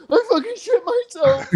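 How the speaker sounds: very high wailing, imitating a crying kid; hammed-up tearful squeal; mock crying. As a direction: wail it like a bawling child. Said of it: "he's crying and shit".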